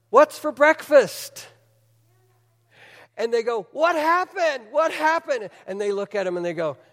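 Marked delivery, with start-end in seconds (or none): 0.1-1.5 put-on high, drawn-out little girl's question. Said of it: "little girl comes out and says". 3.7-5.5 high-pitched mock astonishment; astonished mourners. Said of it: "the surprise on all the mourners' face".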